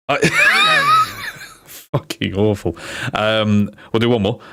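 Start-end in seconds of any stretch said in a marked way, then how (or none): 0.2-1.3 very high-pitched burst of laughter; squealing laugh. Real, not acted. Streamer cracking up at an awful pun, squealing laugh.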